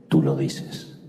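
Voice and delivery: very low, restrained answer; near-monotone reply. Acted. Accused carpenter answers Pilate without pleading, low near-monotone. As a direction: answer in a deep monotone without raising your voice. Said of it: "sin levantar la voz en un tono casi monocorde".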